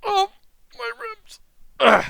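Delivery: thin, high pained whimper; acted rib pain. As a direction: groan weakly as if badly hurt. Injured fighter groans over his ribs.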